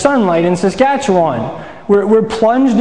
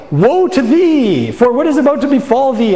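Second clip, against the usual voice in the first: put-on old-fashioned proclamation; 6 semitones higher than usual. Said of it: "we just don't really talk like that".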